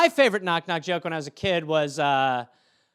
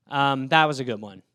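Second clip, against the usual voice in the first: ordinary delivery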